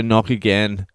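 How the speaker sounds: usual speaking voice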